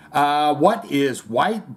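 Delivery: ordinary talk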